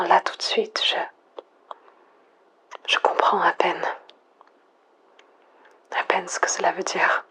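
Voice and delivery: soft, breathy near-whisper into the mic; quiet, intimate monologue alone at night; hushed whisper. Acted. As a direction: whisper as if afraid to break the silence. Lone survivor whispering into mic.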